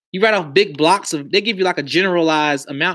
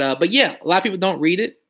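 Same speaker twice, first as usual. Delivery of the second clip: normal talking voice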